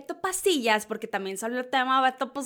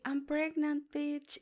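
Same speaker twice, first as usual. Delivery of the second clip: much softer, a bit higher, sassy hush; sly hushed reveal; 3 semitones higher, 8 dB quieter, much slower than usual. Retold or imitated. Host voicing Yuya's pregnancy reveal, soft and sassy. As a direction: drop it softly like a bombshell secret.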